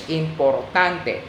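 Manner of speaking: "importante" sounded out slowly, eem-por-TAHN-teh; Spanish pronunciation. Shown as a demonstration. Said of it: "that makes it Spanish".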